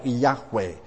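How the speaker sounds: "Yahweh" with a throaty kh in the middle; scholarly "Yahweh", h sounded like the ch in loch; Yahweh pronunciation. Shown as a demonstration. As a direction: say "Yahweh" as YAKH-way. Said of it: "probably was something like".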